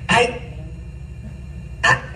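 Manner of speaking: normal talking voice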